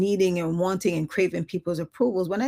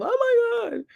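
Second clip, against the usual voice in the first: exasperated oh my God; 5 dB louder than usual. Retold or imitated. Exasperated inner reaction.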